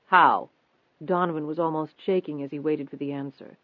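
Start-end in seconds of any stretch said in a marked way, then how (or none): 0.1-0.5 low, loud one-word question; pressing question. A narrator's reading. Reporter asking how it was fixed, pressing.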